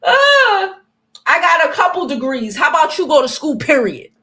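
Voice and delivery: loud, high yelling; shouting. Real, not acted. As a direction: yell it back at them. Yelling at chat about degrees.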